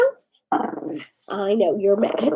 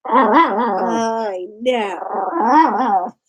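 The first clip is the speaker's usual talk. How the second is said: teary comfort; 8 semitones lower than usual. Real, not acted. Comforting, emotional.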